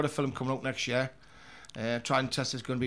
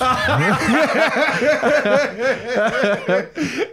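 big high belly laugh; loud laugh; 9 semitones higher, 12 dB louder than usual. Real, not acted. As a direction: burst out laughing loudly. Big laugh at kids question.

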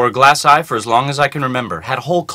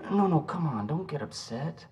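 much softer, soothing plea; placating; 4 semitones higher, 12 dB quieter than usual. Acted. Calming upset girlfriend on the phone, soft placating. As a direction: back off softly and placate.

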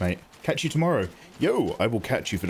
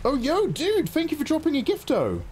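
high, hyped-up thank-you; excited thanks. Real, not acted. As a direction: greet the donor with a burst of hype. Thanking a viewer for gift.

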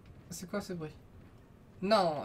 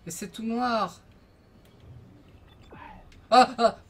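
scared horror game streamer; 6 semitones higher, 5 dB louder, slower than usual. Real, not acted.

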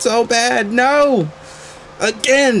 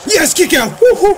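excited cheer; 11 semitones higher than usual. Real, not acted. Gamer cheering after kicking out of the pin, high whoops. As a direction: whoop with relief and joy.

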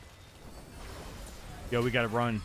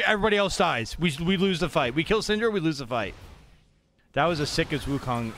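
usual speaking voice

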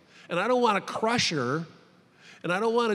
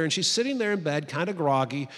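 ordinary delivery

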